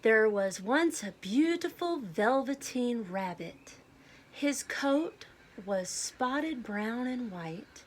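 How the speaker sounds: clear, lively storybook read-aloud; children's story narration with gentle emphasis. Acted. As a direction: read it like a picture book to a class. Teacher reads picture book aloud.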